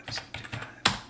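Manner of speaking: softly whispered digits; whispered repeat. Shown as a demonstration. Tutor double-checking digits alone, whispering softly. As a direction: echo the number to yourself in a whisper. Repeating the number under breath.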